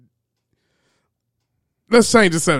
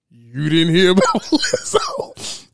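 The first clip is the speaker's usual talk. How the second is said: mock disbelief; 5 semitones higher, slower than usual. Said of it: "he gave it a look like".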